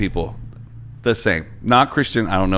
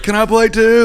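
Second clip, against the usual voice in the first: imitating an eager kid, much higher pitched; eager child; 12 semitones higher, 4 dB louder, slower than usual. Host mimics grown actor joining kids' games, eager child voice. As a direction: pipe up like a little boy wanting in. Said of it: "he's like".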